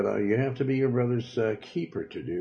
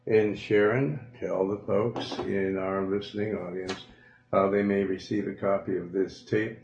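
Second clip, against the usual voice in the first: usual speaking voice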